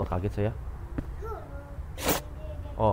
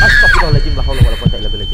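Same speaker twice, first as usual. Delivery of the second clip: high, loud panicked cries of prayer; frightened shout; 12 dB louder, much faster than usual. Real, not acted. Gamer startled by a loud noise, shouting in fear. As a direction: yelp it out in real fright.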